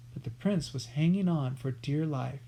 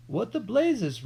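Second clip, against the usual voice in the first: raised, high roar; 6 semitones higher, 4 dB louder than usual. A narrator's reading. Outlaw Billy at the intruders, raised roar. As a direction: roar out in surprise. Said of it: "roared Hold-your-nose Billy".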